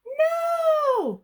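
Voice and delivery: high, loud frightened shout; terrified no. Acted. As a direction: shriek in panic. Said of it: "cried the witch".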